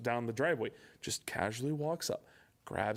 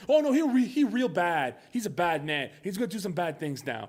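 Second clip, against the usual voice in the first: mock alarm; 4 semitones higher, 6 dB louder than usual. Mocking the film's ending.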